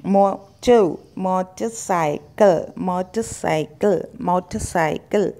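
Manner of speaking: मोटरसाइकिल slowly, silent R, "kul" ending; correct pronunciation. Shown as a demonstration. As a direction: repeat मोटरसाइकिल in separate parts for practice. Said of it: "कल बोलना है यहाँ पे". (मोटरसाइकिल का सही उच्चारण, कल के साथ)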